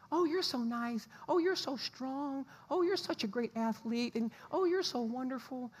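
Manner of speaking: high, syrupy mock gushing; imitating someone overdoing the praise; gushing compliments. Husband imitating his wife's gushing compliments, high and sugary. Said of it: "she was always saying nice things".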